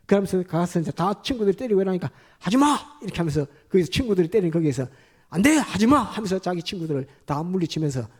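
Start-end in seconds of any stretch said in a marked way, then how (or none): 2.4-2.8 shout. Retold or imitated. Stopping friends beating woman. 5.3-6.0 shout. Retold or imitated. Stopping friends beating woman.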